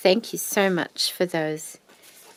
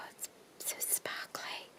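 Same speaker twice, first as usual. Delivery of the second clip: whisper=40; 18 dB quieter than usual. Real, not acted.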